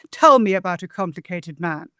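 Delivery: "Tell" stressed first, then a steady iambic beat. Shown as a demonstration. Translator reciting her first line with inverted stress. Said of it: "I'm inverting the first foot".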